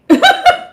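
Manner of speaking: short high-pitched shriek of a laugh; sudden high laugh; high squeal of laughter. Real, not acted. Laughing after the warning.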